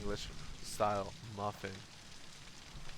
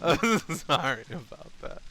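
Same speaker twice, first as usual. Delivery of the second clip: high, breaking into real giggles; laughing apology; 8 semitones higher, 4 dB louder, slower than usual. Real, not acted. Streamer apologising to Digby for the bit, laughing. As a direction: apologise through helpless laughter.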